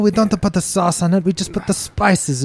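dismissive mock Portuguese baker; fake Portuguese accent. Scoffing at Italian bread in a fake Portuguese accent. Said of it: "racially ambiguous fucking accent".

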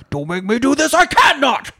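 hammed-up anguished plea climbing to a strained shout; Brad Pitt. Host mimics the one non-monotone line, anguished outburst. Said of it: "the one time he's not a monotone".

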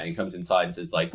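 usual speaking voice

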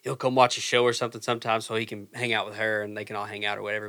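ordinary talk

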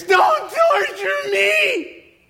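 very slow, high, tortured shriek; screaming. Acted. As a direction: scream a desperate plea, stretching every word. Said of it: "and just screams".

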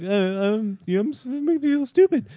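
high, hesitant whine; whiny high voice. Retold or imitated. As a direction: whimper it like a hurt kid. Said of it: "because it'd be like".

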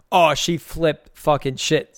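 normal talking voice

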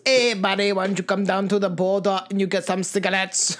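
stiff mock Canadian accent; robot Canadian accent. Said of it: "Robot Canadian".

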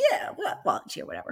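low, soft mock Boston accent. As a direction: imitate a tough guy's offhand Boston mumble. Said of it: "in his Boston accent".

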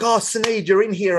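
bright, high-pitched delighted hello; excited greeting. Retold or imitated. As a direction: greet her with happy surprise.

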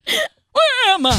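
confused, high and slow, laughing; dazed high voice. Retold or imitated. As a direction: wake up baffled, laughing. Dazed prisoner voice while laughing.

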